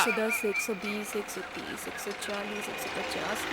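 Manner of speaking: very soft, low murmur; hushed, half-whispered counting; counting under breath. Acted. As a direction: count money quietly to yourself. Counts money to herself.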